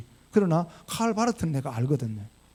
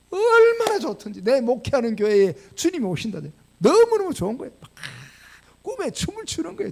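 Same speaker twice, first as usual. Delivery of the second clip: gushing delight, voice soaring up; overjoyed; 7 semitones higher, 3 dB louder than usual. Retold or imitated. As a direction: ring out with delight at the memory. Recalls joy of dream visit.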